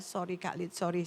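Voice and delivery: retold tearful apology. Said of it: "tambah dia nangis sambil dia".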